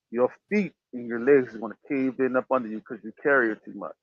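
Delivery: ordinary delivery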